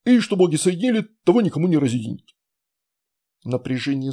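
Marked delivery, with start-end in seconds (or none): 0.0-2.4 fast rushed finish; hurried. A narrator's reading. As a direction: rush to finish the rite. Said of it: "торопливо завершил".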